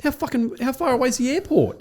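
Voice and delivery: high-pitched retold exasperation; acted incredulous asking; exasperated question. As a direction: demand the distance, voice way up.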